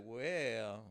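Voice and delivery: low, soft, reluctant delivery; subdued bad news. Woman tells man of the new law, low and subdued. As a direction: break it gently, dropping pitch and volume.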